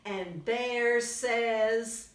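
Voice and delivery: put-on lively read-aloud for little kids; storybook reading. A narrator's reading. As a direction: tell it brightly to a young audience. Reads picture book to children.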